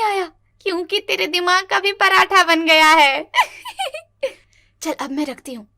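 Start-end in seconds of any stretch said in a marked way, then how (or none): 3.4-4.0 high cackling laugh at her own joke; burst of laughter. Acted.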